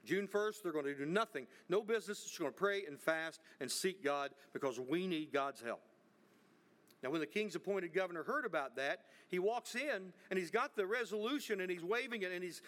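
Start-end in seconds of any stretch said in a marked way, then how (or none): none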